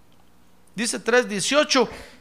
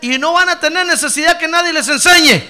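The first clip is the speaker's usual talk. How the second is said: loud; 7 semitones higher, 12 dB louder than usual. Acted. Cita de Jesús predicando.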